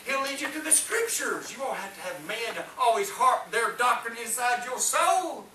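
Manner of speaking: ordinary talk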